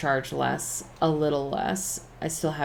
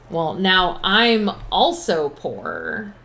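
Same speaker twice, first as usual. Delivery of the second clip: dismayed drawl; 2 semitones higher, 6 dB louder, much slower than usual. Real, not acted. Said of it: "Oh shit, I don't have any money".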